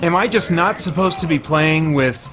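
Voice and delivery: ordinary delivery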